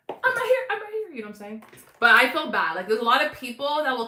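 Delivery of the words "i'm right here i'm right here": high, pleading mock voice; imitating a whiny viewer; needy mock call. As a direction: play the attention-seeker. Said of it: "don't be like".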